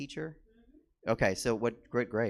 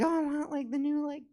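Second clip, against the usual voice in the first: put-on high little-boy voice; small, high kid voice; 13 semitones higher, 4 dB quieter than usual. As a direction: imitate a child asking Santa for toys. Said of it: "He was like".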